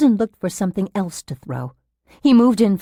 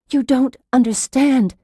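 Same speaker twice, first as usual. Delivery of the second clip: higher, desperate insistence; agitated, pressing protest; urgent protest; 7 semitones higher, 4 dB louder than usual. A narrator's reading. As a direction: plead to be believed. Patient urgently trying to convince nurse.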